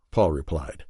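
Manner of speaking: usual speaking voice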